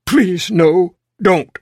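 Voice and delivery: high, loud frightened begging; terrified pleading. Acted. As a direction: beg the monster to stop. Man pleads with the monster.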